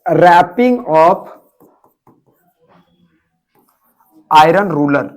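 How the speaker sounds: very slow, with long pauses between the words; slow dictation. Shown as a demonstration. Teacher presenting the new phrase to the class, very slowly. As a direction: stretch out "Wrapping of", then "iron ruler". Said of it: "और फिर क्या पता है?".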